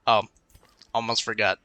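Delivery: usual speaking voice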